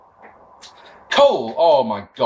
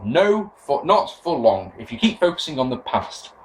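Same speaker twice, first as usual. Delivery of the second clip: ordinary talk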